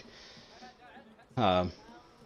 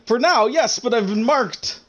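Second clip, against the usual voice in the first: despairing; 9 semitones higher, 4 dB louder, slower than usual. Acted. Doomed shopkeeper despairs.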